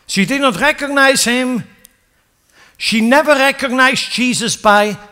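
high, loud preaching build; fiery preaching. Acted. Preacher driving the story home to the congregation, fiery. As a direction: preach it out to the church.

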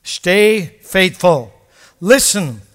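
drawn-out, loud plea; slow earnest charge. Real, not acted. Preacher urging the faithful to stay faithful, slow and loud. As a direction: plant each word slowly and firmly. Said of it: "slow exhortation".

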